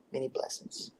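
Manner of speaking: soft whispered farewell; whispered blessing. Real, not acted. Signing off to the hosts, whispered. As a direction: breathe the words softly.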